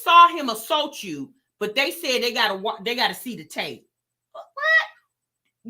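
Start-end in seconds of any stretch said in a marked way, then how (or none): 4.3-5.7 high, sharp what; disbelieving squeak of a what; shocked high what; outraged what. Real, not acted.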